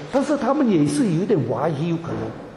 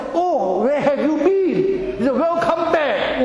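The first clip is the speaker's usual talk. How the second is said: high, very slow, sing-song welcome; a drawn-out, beaming hello; cheery casino host; 7 semitones higher, much slower than usual. Retold or imitated. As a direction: greet a returning regular with delight. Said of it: "打在电脑那边说".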